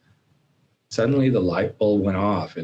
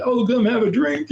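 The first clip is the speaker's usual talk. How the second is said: high-pitched mock invitation, a bit louder; coaxing friends; 4 dB louder than usual. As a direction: imitate pals luring someone in, pitched way up. Acting out pushy friends at bar.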